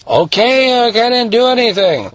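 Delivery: put-on high protesting boy voice; whiny kid. Son answering his dad, high and protesting. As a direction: plead innocence like a sulky kid.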